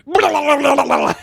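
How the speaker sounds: loud, high tongue-waggling babble; exaggerated lolling-tongue trill; mock tonguing noise. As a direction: imitate sloppy full tonguing. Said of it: "and just full tonguing".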